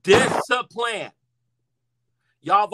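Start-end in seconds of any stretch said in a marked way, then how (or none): 0.0-1.1 slow, drawn-out 'Discipline'; emphatic. Real, not acted. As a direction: stretch 'Discipline' slowly and firmly. Emphatic repeat.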